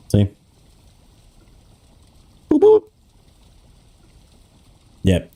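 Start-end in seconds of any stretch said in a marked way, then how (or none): 2.5-2.8 tiny squeaky boops; high boop noise. Pretend. Father showing how the tassel swings for his son. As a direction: pipe a playful boop, boop.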